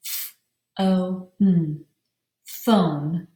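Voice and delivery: "F O N" as separate sounds, then "phone"; "F O N" broken apart, then blended into "phone"; sounded out. Shown as a demonstration. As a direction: sound out a breathy "F", long "O", hummed "N". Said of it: "pound out the syllables".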